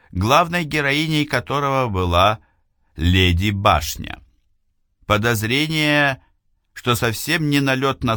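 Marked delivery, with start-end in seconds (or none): none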